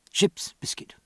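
breathy whispered echo. A narrator's reading. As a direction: whisper it with longing.

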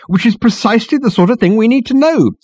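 very high, loud, strident voice; insistent. A narrator's reading. Marketing girl takes up Ford's jibe, insistent. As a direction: insist on every word. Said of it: "insisted the girl".

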